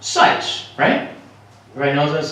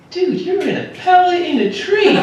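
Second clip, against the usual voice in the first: deer voice; 11 semitones higher than usual. Retold or imitated. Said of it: "She goes".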